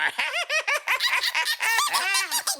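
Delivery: squeaky high-pitched cackle of glee; high cartoon laugh. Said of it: "Rocket laughs along with Groot".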